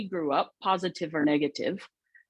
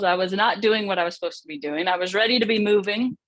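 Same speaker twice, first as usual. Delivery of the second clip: ordinary delivery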